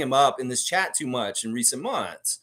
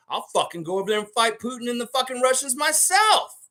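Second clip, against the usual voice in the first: fired-up vow; 11 semitones higher than usual. Real, not acted. Heated rant about fighting Russia.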